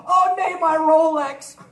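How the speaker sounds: high loud horrified wail, slowing on Rolex; shocked cry. Acted. Rich man Karl discovering his arm is gone, high horrified cry. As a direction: gasp out in horror. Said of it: "Der Karl war erschrocken und hat gesagt".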